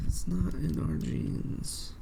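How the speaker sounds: slow whispered mock alien line, stretching "not"; mock Kett whisper. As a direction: whisper "It's not in our genes" very slowly, parodying the Kett. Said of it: "why are the Kett talking like this".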